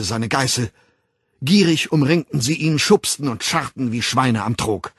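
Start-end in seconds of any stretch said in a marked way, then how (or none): none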